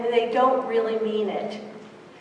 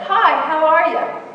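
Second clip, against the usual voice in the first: loud, raised-pitch passing hello; hurried polite greeting; 4 semitones higher, 7 dB louder than usual. Acted. Hurrying to work, greeting someone in the hall, perfunctory. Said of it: "and I'm in a hurry".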